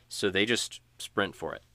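ordinary talk

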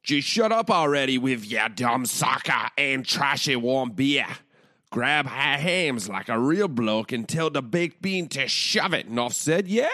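loud, high mock Cockney ad read; over-the-top fake Cockney accent, shouted; bad Cockney accent. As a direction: ham up a Cockney bloke for a beer ad. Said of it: "do this Hamm's ad in a Cockney accent".

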